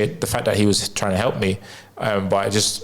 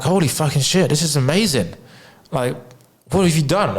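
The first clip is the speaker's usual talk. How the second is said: higher-pitched retold astonishment; amazed; 5 semitones higher than usual. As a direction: relive being blown away, pitch jumping up. Retelling his amazement at sudden relief.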